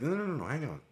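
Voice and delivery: urgent protest. Retold or imitated. Speaker frantically interrupts the angry coworker, pitched high. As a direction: jump in quickly to halt him. Speaker hurriedly cuts in on the phone.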